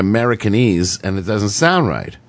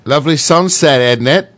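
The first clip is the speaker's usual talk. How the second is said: British accent, pitched up; 3 semitones higher, 3 dB louder than usual. Shown as a demonstration. Showing the British phrase, put-on British accent. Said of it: "The British".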